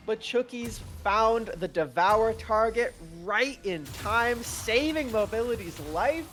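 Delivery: high, animated caster delivery; excited fight recap. Real, not acted. Co-caster praising a valiant effort, excited commentary. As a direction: replay the drama with admiration.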